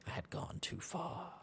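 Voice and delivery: whispered in mock horror; a hushed, dramatic confession; horrified whisper. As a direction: breathe it out in horror.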